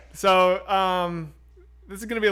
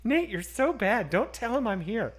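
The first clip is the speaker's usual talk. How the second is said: giggly mom; 6 semitones higher, slower than usual. Retold or imitated. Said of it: "She goes".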